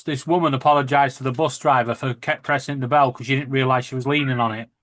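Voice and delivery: ordinary talk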